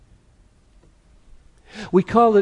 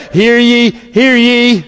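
a hint of a town crier; loud herald's call to the square; 10 semitones higher, 12 dB louder, slower than usual. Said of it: "That's the herald".

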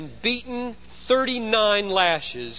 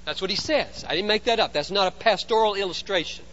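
usual speaking voice

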